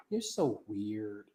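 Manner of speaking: a hint of a teenage daughter teasing her dad; mock daughter. Dad lightly mimicking his daughter calling him weird. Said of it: "She like".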